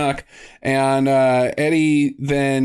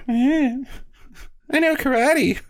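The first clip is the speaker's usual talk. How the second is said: high, drawn-out silly voice; mock karate braggart; 10 semitones higher, much slower than usual. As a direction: stretch it out in a high, goofy voice. Joke role-play as a pretended character.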